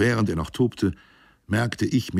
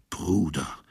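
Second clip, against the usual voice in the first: „Bruder“ tonlos geflüstert; „Bruder“ nur gehaucht, ohne Stimme. Acted. Flüstert das Wort verächtlich nach. (geflüstertes „Bruder“)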